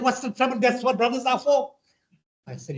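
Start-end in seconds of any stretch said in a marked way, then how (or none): 0.0-1.6 friendly airman. Retold or imitated. Airman's reply, high voice.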